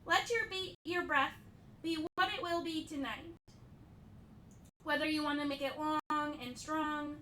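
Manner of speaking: softened, measured cueing with long silences; guided yoga instruction. Acted. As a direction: guide them through breath and pose, leaving room between phrases. Leads breathing and yin yoga poses.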